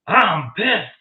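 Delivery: high, loud retold outburst; imitated angry yell. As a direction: imitate a sudden yell from out of nowhere. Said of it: "you hear a male voice yell".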